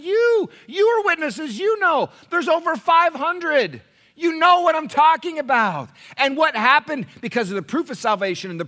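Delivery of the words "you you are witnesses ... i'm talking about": high, slow, loud apostle's appeal; Paul preaching. Retold or imitated. Paul telling the church there are witnesses. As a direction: proclaim it as Paul. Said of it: "Paul said".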